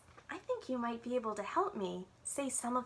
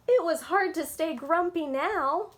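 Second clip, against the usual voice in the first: delighted; 5 semitones higher, 7 dB louder than usual. A narrator's reading. Delighted reading after gasp.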